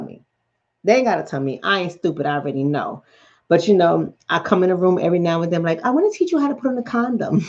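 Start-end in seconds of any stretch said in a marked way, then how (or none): 5.8-7.4 higher, quick singsongy offer; teasing mom announcement. Retold or imitated. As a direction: embarrass your kid with a quick, pitched-up offer. Acts out herself teasing her son.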